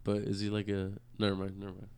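ordinary talk, nothing marked

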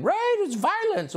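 high, slow, sing-songy "Right, it's violence."; mock her lilting cable-news voice; sing-songy mock activist. Host mocking activist on cable news, high sing-song. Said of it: "on cable news saying".

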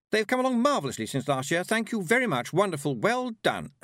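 gushing high-pitched hostess; high, effusive applause-leader voice; trilling praise. A narrator's reading. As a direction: trill the thanks like a gushing compère. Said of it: "she trilled".

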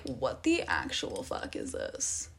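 low, hushed, slowed-down disbelief; quietly appalled at the group chat; stunned disbelief. Retold or imitated. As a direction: mutter it like you can't believe what you're reading. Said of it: "And I was like".

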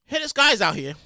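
usual speaking voice

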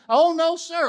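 very high, loud mock protest; hammed-up denial. Sarah acts out answering God's accusation, high hammed-up denial. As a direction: play a panicked, over-polite denial. Acted hasty denial to God.

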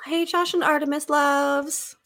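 sing-song greeting. Acted. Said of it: "greeting viewers".

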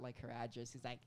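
usual speaking voice

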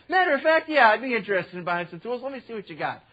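mock eager. Preacher baiting the thieves with fake eagerness. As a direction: play along like a keen buyer. Speaker feigning interest to thieves.

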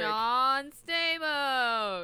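drawn-out swooning name. Pretend. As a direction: stretch it out like a starstruck fan.

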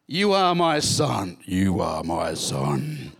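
hammed-up voice from heaven; booming voice of God. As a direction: boom it out from the heavens. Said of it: "Just imagine this booming voice".

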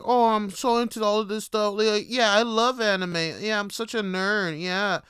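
high, loud, gushing imitation; mock a woman gushing about her hobby; mock self-proclaimed nerd girl. Calling out women who claim to be nerds, mocking gushy voice. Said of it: "saying you're nerds. It's like".

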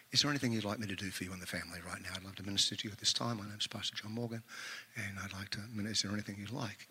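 soft, low, hushed consoling tone; pastor voice. Retold or imitated. Pastor greeting a grieving family, soft low consoling voice. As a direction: ease in gently, like comforting the grieving. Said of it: "this is the pastor voice".

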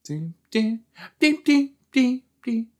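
very high chiming dings; tiny high-pitched phone jingle; imitated ringtone. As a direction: chirp little ringing notes like a phone. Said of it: "I want that ringtone".

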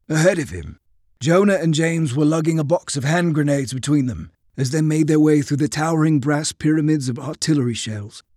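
ordinary delivery, nothing marked

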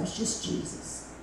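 breathless whispered echo. Retold or imitated. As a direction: breathe it out tenderly. Repeated as a whisper.

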